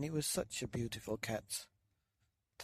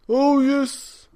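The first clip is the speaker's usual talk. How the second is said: put-on high, loud old man agreeing; old man agreeing, pitched high; 14 semitones higher, 17 dB louder than usual. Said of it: "said the very old man".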